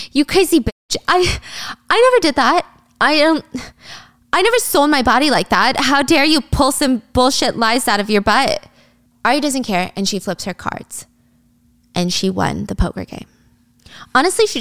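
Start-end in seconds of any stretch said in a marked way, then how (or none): 0.1-8.7 high-pitched offended outburst; affronted, voice jumping high; acted indignation. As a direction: sputter in outraged denial. Yuri indignant at accusation.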